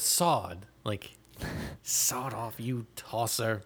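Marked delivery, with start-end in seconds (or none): 1.8-3.6 low, slow, weighty insult; insult with oomph. Shown as a demonstration. A podcaster relishing a British swear, low and slow with oomph. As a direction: draw it out low and deliberate. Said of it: "we're missing the oomph behind".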